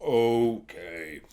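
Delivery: mock reluctant dragon; resigned dragon. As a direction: give in like a weary dragon. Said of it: "he's like".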